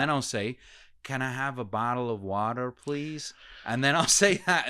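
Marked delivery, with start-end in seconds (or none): 1.0-3.3 careful American accent. Shown as a demonstration. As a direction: say it properly the American way, unhurried. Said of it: "say it properly for here".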